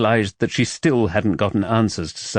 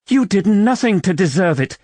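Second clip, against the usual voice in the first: sharp, much higher and louder protest; indignant outburst, raised and heated; hotly; 10 semitones higher, 4 dB louder than usual. A narrator's reading. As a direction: fire it back in hot defense of him. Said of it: "she said hotly".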